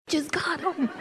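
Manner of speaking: hushed, laughing whisper; whispered aside. Acted. Comic whispering the news about her new boyfriend. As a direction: whisper it like a secret confession.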